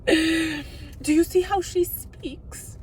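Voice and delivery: high, slow mock posh British woman. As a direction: ham up a snooty British lady. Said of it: "sophisticated British woman".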